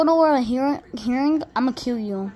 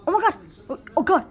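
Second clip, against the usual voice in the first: ordinary talk, nothing marked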